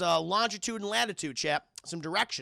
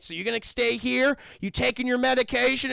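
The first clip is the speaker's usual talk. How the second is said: louder, higher, pressing questions; stern questioning; 6 semitones higher, 7 dB louder than usual. Retold or imitated. Logan checking on Charles before leaving, loud stern questioning. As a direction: press him loudly for an answer.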